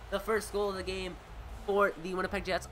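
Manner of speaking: brisk sportscaster call, rising on the shots; live play-by-play. Acted. Streamer calling the hockey game for his viewers, lively play-by-play.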